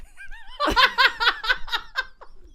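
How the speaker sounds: high burst of laughter; laughing. Real, not acted. Retelling a funny movie moment, bursting into laughter.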